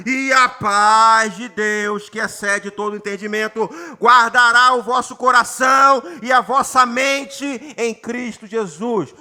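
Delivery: solemn sermon reading; intoned scripture reading. Acted. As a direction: proclaim the verse phrase by phrase. Pastor re-reads the verse.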